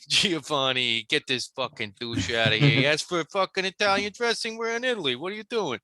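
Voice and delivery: high, hammed-up Italian tough guy; mock Italian accent. Waiter calls Giovanni to toss the customer, in a high mock Italian accent. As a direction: yell for Giovanni in an over-the-top Italian.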